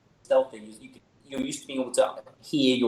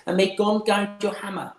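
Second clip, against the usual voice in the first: higher, formal blessing; a voice in reply; 9 semitones higher, 4 dB louder than usual. Acted. Hidden voice returning the blessing, higher. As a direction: answer the blessing from behind. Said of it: "the voice says".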